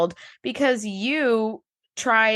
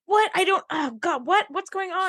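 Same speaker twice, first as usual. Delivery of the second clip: panicked mother; 8 semitones higher than usual. Retold or imitated. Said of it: "Beverly's like".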